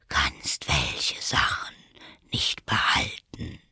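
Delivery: low, slow whispery drawl; sleepy caterpillar. A narrator's reading. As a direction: contradict drowsily, half whispered. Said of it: "mit schläfriger, langsamer Stimme". (tief, langsam und schläfrig gehaucht)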